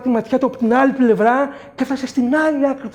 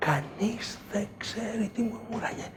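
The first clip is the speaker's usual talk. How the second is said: whispered secret aside; hushed whisper; 12 dB quieter than usual. A narrator's reading. Storyteller hushes for the muttering girl, whispered. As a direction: breathe it as a secret.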